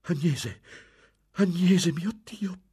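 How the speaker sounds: high, loud cry of recognition; shocked cry. A narrator's reading. Lorenzo recognising his sister Agnese, crying out. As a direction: cry out, rising sharply on the repeat. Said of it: "gridò Lorenzo".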